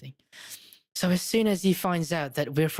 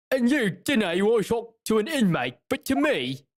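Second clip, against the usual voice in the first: slow, higher Cockney governor; Cockney accent; 4 semitones higher, 3 dB louder, slower than usual. Acted. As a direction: draw it out in a rough-and-ready Cockney. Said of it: "gonna give him a Cockney accent".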